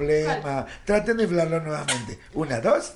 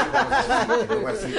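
laughing; 6 semitones higher, 4 dB louder, faster than usual. Real, not acted. Laughing while demonstrating.

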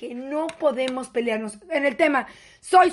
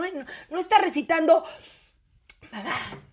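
ordinary delivery

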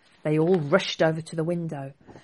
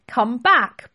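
loud, high yell; 7 semitones higher, 8 dB louder than usual. Acted. Prince chasing the boy down the street, yelling. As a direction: call after him at the top of your voice. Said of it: "Prince Dev yelled".

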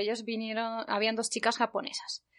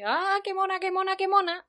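higher excited cooing; high gushing qué mona; excited fans; 10 semitones higher than usual. Retold or imitated. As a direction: gush over her like a fan. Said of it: "las dos japonesas estaban ahí".

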